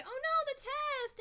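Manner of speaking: high, loud, slow alarmed cry; mock panic; panicked girl. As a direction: gasp it like waking from a nightmare. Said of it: "Blossom was like".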